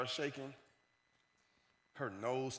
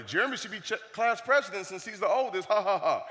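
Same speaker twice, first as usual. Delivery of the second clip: high, loud jeering with fake laugh; mocking joke; 5 semitones higher, 6 dB louder than usual. Classmates joking about the oldest student, mocking. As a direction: imitate a classmate's taunt, ending ha-ha-ha. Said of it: "I could see the jokes already".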